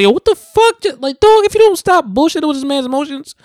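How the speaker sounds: very high, loud, exasperated outburst; emotional outburst. Real, not acted. Viewer yells at the dude on screen, emotional outburst. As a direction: yell it at the screen, pitch shooting way up. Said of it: "I got emotional saying that".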